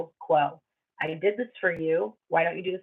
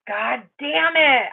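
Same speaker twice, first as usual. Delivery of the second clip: slow, drawn-out frustrated curse; real annoyed curse; 4 semitones higher, 4 dB louder, much slower than usual. Real, not acted. Reader cursing when the connection drops, slowly and annoyed. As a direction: let the irritation drag out. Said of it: "I'm really annoyed".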